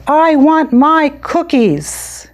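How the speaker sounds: put-on high, slow child's yell; yelled demand. Katie demanding her cookies from the teacher, yelling. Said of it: "and yelled".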